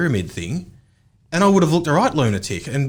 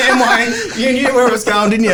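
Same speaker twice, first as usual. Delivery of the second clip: laughing; 10 semitones higher, 5 dB louder than usual. Real, not acted. Laughing at Amway reveal.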